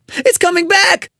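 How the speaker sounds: high, panicked yelp; alarmed, high-pitched cry; frightened yelp. A narrator's reading. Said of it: "I yelped".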